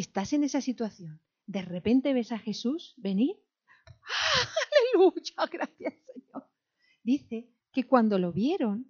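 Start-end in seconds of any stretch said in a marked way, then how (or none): 3.9-6.5 high gasp, then tearful-sounding hallelujah; hammed-up high joyful cry; acted overjoyed relief. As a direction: gasp and squeal with relief, fading into thanks. Acts sudden relief on seeing Jesus.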